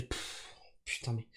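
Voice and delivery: ordinary talk, nothing marked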